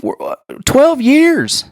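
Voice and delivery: high, drawn-out incredulous repeat; exasperated. Retold or imitated. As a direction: stretch each word high in mock disbelief. Retold exasperated answer to partner.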